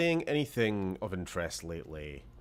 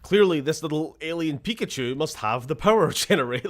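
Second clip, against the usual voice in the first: mocking inner voice; 6 semitones higher, 8 dB louder than usual. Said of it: "would my brain go".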